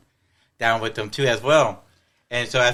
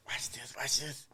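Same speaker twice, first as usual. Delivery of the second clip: hushed, secretive whisper; very soft, voiceless aside; conspiratorial whisper; 16 dB quieter than usual. Retold or imitated. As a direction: whisper it like setting up a prank. Secretly tips off son in back seat.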